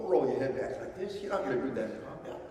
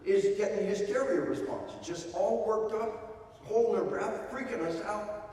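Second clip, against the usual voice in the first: worried dad; 6 semitones higher than usual. Retold or imitated. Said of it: "a cop told me".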